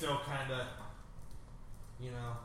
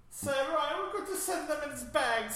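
high squeaky fake German accent; mock German accent; 12 semitones higher, 5 dB louder than usual. Friends dub the movie scene, mock German accent. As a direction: squeak it out like a nervous German henchman.

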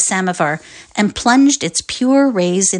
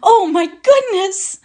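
excited exclamation; 12 semitones higher, 4 dB louder, slower than usual. Acted. Family excitedly hearing of arrival.

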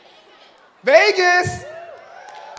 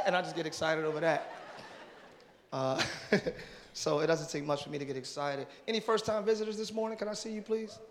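usual speaking voice

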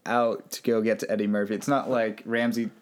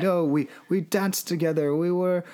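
higher, slowing pleading; lightly acted lovesick plea; mock pleading; 8 semitones higher than usual. Gracie insisting to Sarah they were in love, higher mock plea.

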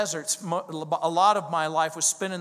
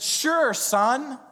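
[name]' voice; 6 semitones higher than usual. Retold or imitated. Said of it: "And he said".

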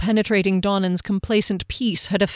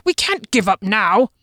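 growled, louder protest; growling; 2 semitones higher, 4 dB louder than usual. A narrator's reading. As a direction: growl it insistently. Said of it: "Janet growled from behind".

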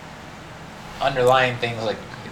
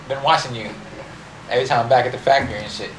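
normal talking voice

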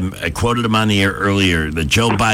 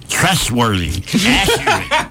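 tough promo read; 4 semitones higher than usual. Shown as a demonstration. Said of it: "he'll kick your ass. So try this".

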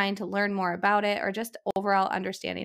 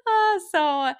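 laughing hard; 11 semitones higher, 3 dB louder than usual. Real, not acted. Laughing after a joke.

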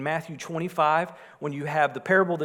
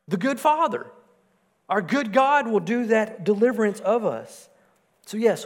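higher, louder pleading cry; fervent sermon voice; impassioned preaching; 7 semitones higher, 6 dB louder than usual. Acted. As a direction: preach it out like a lament. Impassioned preaching of Romans verse.

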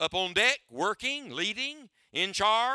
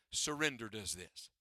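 "Surrender" dropped lower and much softer; 8 semitones lower, 8 dB quieter than usual. Shown as a demonstration. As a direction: let "Surrender" sink down, low and hushed. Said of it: "Watch here. Look up here".

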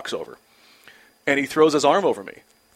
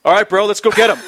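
loud, higher hype line; pumped-up reply; 7 semitones higher, 8 dB louder, faster than usual. Retold or imitated. Rookie answering the pep talk, loud mock hype. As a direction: fire back like a teammate. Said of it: "I just looked at him like".

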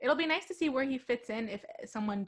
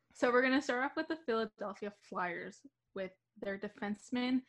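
normal talking voice

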